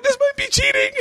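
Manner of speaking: very high, whimpering comic cry; hammed-up guilty whine; mock distressed squeal. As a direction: wail it like a helpless confession. Repeats guilty outburst mid-session.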